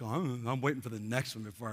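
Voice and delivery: lightly voiced daydreaming artist; mock dreamy artist. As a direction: imitate a scattered creative, trailing off. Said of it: "You're, you're like".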